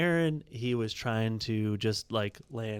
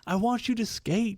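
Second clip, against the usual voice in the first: much higher, eager voice; excited retold insistence; 10 semitones higher than usual. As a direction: insist to the kid with high excitement. Retold emphatic reply again.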